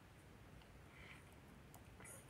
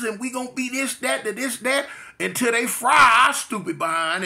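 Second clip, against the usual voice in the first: high, hammed-up jeering at a cellmate; mocking taunt; 7 semitones higher, 4 dB louder than usual. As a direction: rub it in, loud and gleeful, building to a shout. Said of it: "the clowning commenced".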